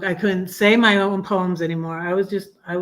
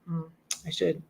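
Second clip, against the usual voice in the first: usual speaking voice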